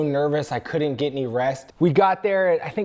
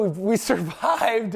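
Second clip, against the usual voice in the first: slow, laughing retold triumph; ecstatic; 4 semitones higher than usual. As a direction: laugh with relief at having survived. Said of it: "very ecstatic feeling there".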